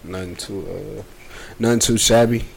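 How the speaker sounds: ordinary delivery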